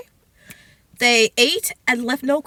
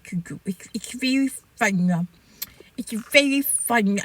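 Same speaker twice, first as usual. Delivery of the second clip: put-on playful babyish voice, lower and slow; 3 semitones lower, slower than usual. Host excusing a silly habit, lightly babyish voice. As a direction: slip lightly into slow baby talk. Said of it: "like, a babyish voice".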